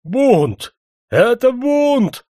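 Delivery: very high, loud panicked scream; frantic, drawn-out high yell; hysterical shout. A narrator's reading. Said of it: "истерически закричал он".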